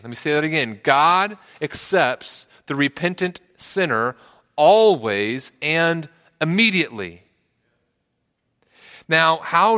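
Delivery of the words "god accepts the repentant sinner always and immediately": very deliberate, "always" and "immediately" each set apart; slow right down and lean on "always"; emphatic restatement. Real, not acted. Preacher saying the truth over for the church, emphatic.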